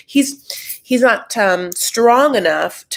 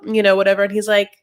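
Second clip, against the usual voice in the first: usual speaking voice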